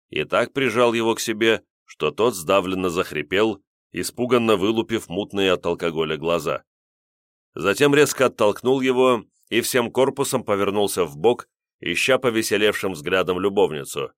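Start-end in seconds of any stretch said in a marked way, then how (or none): none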